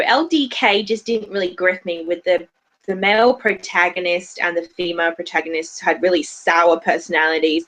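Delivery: usual speaking voice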